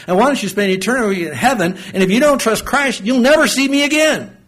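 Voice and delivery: building from earnest to a high, loud plea; rising impassioned plea. Retold or imitated. Eighty-year-old pleading with the kids, climbing to a high loud plea.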